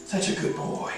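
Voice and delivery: whispered puppy praise; whispering. Real, not acted. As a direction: breathe the praise softly.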